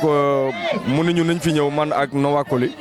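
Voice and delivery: ordinary talk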